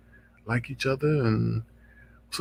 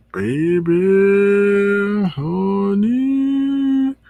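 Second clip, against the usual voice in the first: put-on high, much louder drawn-out baby honey; 8 semitones higher, 5 dB louder, much slower than usual. Host reacting to the threat, loud sassy drawl. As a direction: stretch it out in sassy disbelief.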